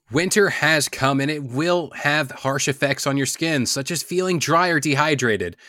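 soft, smooth commercial voiceover; ad read. Acted. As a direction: sell it gently like a spa ad. Reads sponsor ad for cleanser.

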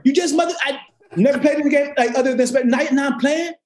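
high, half-joking astonishment; mock disbelief. Still reacting in disbelief.

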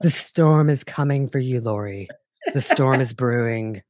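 low, creepy monotone warning; menacing whisper-like prophecy; low brooding monotone; creepy Chad voice. Retold or imitated. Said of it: "monotone and creepy".

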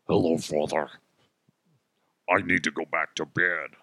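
lightly put-on demonic child voice; a hint of a possessed kid. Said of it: "a demonic voice".

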